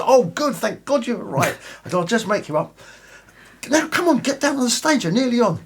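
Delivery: high flustered woman. Acted. Makeup woman hurrying him.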